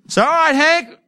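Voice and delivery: high, loud holler to a helper; calling out. Acted. Yelling to Hank to open the fire hose, calling out.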